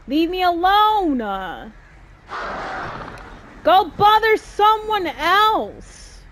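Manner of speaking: angry shouting. Real, not acted. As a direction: yell them away. Shouting at attacking birds.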